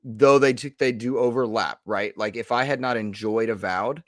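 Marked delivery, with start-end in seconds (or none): none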